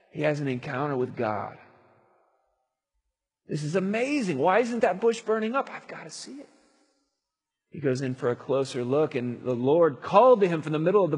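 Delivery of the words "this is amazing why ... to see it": ordinary talk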